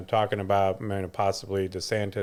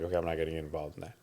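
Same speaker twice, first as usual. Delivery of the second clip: softer, fast throwaway mutter; quick quiet aside; 6 semitones lower, 11 dB quieter, much faster than usual. Retold or imitated. Telling a friend about dodging the kids' food mix-up, a fast soft aside. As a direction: brush it off under your breath, quickly. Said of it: "I'm like".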